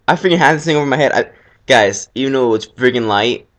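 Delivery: ordinary delivery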